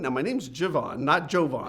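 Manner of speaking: ordinary talk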